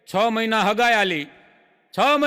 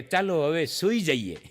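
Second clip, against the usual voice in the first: soft, slow and lower, coaxing; sleepy sweet goodnight; 4 semitones lower, 4 dB quieter than usual. Retold or imitated. Fiancée at five a.m., softly and slowly saying goodnight. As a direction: murmur it like a drowsy fiancée. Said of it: "ઓલી એમ કહે કે".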